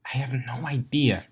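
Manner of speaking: whispered reply, barely voiced. A narrator's reading. Twilight whispering back to Rarity, whispered. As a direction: breathe it out under your breath. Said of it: "the purple unicorn answered".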